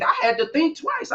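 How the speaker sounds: ordinary talk, nothing marked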